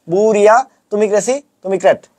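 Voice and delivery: sing-song list, বুরিয়া and crazy rising, ক্র্যাট falling; memorisation drill. Shown as a demonstration. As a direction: chant বুরিয়া, তুমি crazy with a lift and pause after each. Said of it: "আমার সাথে সাথে একদম মুখস্থ".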